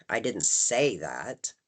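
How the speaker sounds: I didn't say that, with say slow and rising; stress on say. Shown as a demonstration. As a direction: linger on the word say. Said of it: "Putting the emphasis on say".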